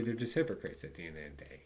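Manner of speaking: ordinary talk, nothing marked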